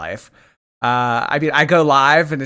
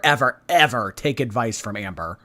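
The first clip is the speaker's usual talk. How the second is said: ordinary talk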